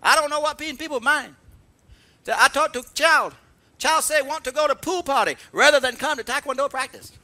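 put-on high, indignant Korean-accented rant; broken Korean-English. Korean master complaining about pool parties, broken Korean-English. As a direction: grumble loudly in imitation Korean-accented English. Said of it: "she talks sort of broken Korean".

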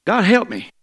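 high desperate cry, God, help me; a plea thrown up high. Shown as a demonstration. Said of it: "You gotta be careful how you say it".

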